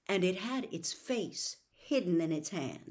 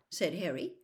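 usual speaking voice

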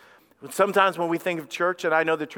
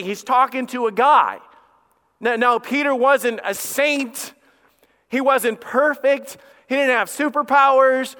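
higher-pitched, insistent pulpit delivery; raised preacher's voice, landing hard on key words; emphatic preaching; 5 semitones higher, 5 dB louder than usual. Real, not acted. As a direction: press each point on the congregation with a raised voice. Preaching at full force.

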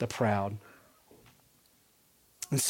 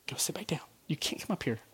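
real whisper; 9 dB quieter, faster than usual. Real, not acted. Speaker quietly telling a child during the sermon to sit back down, whispered. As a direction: whisper so the room won't hear. Child trying to leave mid-talk.

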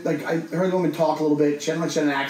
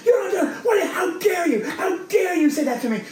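high, raised shriek of fury; screaming woman; 14 semitones higher than usual. Retold or imitated. Crazy woman screaming at him against the subway door. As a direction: scream it right in his face. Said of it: "screaming in my face".

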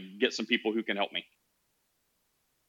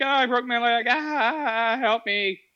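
much higher, pitiful plea for help; whiny injured enemy; 13 semitones higher, slower than usual. Pretend. Enemy with broken leg begs for help, high whiny plea. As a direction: whimper it up high like a hurt man. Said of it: "They'd be like".

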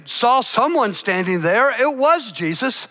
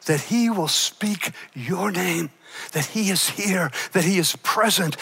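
intimate sermon appeal; 5 semitones lower than usual. Acted. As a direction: lean in and speak to each heart gently. Softer, intimate prayerful appeal.

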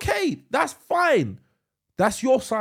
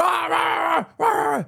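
retold shouting; 9 semitones higher, 3 dB louder, slower than usual. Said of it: "and I'm shouting".

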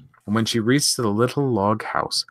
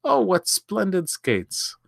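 delighted; 10 semitones higher, slower than usual. A narrator's reading. Child thrilled by Christmas gift.

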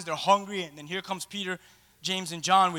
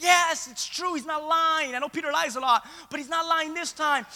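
higher, eager confirming; vouching disciples; 7 semitones higher than usual. Retold or imitated. James and John assuring the others Peter is telling the truth. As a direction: back him up earnestly. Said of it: "James and John are next to Jesus like".

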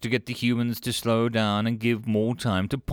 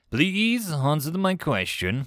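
slow, insistent, higher voice; cutting in; 7 semitones higher, slower than usual. A narrator's reading. Kolch interrupts Steve to get his answer, insistent. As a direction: press for an answer with slow emphasis. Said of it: "Kultich cut in".

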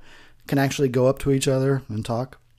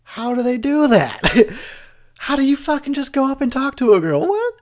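high incredulous voice that settles back down; baffled watcher ranting at the screen; mock disbelief; 6 semitones higher, 4 dB louder than usual. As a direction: pretend you can't believe your eyes. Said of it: "I was like".

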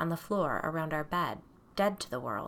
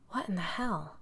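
muttered to myself; 5 dB quieter than usual. A narrator's reading. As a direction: say it to yourself in disbelief. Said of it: "more to myself than anyone else".

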